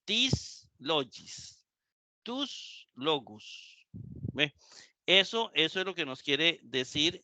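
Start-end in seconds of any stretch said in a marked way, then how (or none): 0.0-1.6 accent "tis", break, then drop for "logis"; "tis... logis", two distinct words; pronounced as separate words. Shown as a demonstration. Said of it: "Esas se pronuncian aparte". 2.2-3.8 "tus... logus", two distinct words; pronounced as separate words. Shown as a demonstration. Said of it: "Esas se pronuncian aparte".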